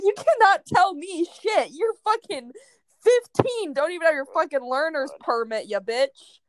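mock outrage at the boy; mock angry rant. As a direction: scold him like a kid, hammed up. Ranting at fifteen-year-old boy.

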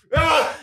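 shrieking burst of laughter; big high-pitched laughing outburst; loud high laugh. Real, not acted.

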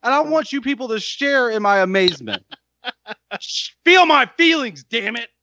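high, giddy insistence; overflowing with delight; excited. Real, not acted. Demands others share his amazement.

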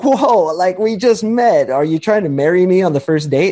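high startled whoa, backing off; mock alarm at being rushed; guy on a dating app, startled by her question; surprised. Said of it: "And you're like".

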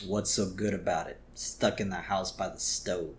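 low, soft imitated grumble; Lisa. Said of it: "she is not happy".

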